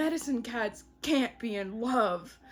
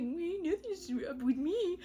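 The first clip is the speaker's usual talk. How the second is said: higher, put-on innocence; mock denial; 5 semitones higher, 4 dB quieter than usual. Boyfriend brushing off her concern, high mock innocence. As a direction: deny it in an imitated, pitched-up voice.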